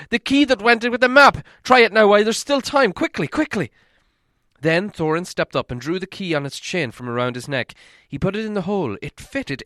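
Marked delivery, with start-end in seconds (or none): 0.1-3.7 much higher, much louder urging; urgent shout. Acted. Bilbo urging Thorin to try the key now, shouting. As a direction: hurry him with everything you have. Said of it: "shouted Bilbo".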